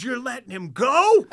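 high, loud outraged yell through laughter; mock screaming. Pat yelling at the cop in outrage, loud. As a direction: yell it in disbelief, half laughing. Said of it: "He's screaming".

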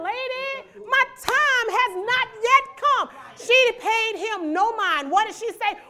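loud preaching, rising to a shout; full-force preaching. Acted. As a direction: preach it hard and build. Preaching the Cana story.

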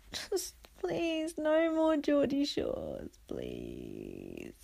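high, soft, drawn-out begging; mock-tearful plea. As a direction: plead weakly as if close to tears.

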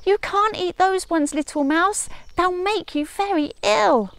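high chirpy bird voice warning the mouse; trilling bird warning. Acted. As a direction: warn like a little bird with a trill. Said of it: "warned her with a trill".

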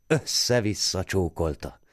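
usual speaking voice

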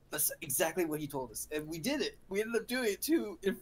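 ordinary delivery

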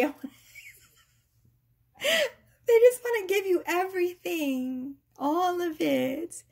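normal talking voice

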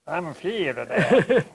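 mock a Texan saying it slowly; imitating a Texan accent in English. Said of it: "les Texiens vont dire".

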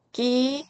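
tense the onset of 끼, no air. Shown as a demonstration. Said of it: "이렇게 읽으시고요". (된소리로 높게 끼)

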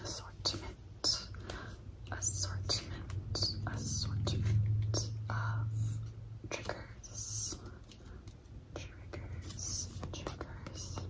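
drawn-out whispered repetition, fading; slow repeated trigger words. Acted. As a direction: savour each word as an ASMR trigger. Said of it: "repeated trigger words".

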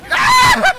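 very high, loud shriek; shrieking scream. Real, not acted. Player startled by the game, high loud scream. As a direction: let out a piercing high shriek.

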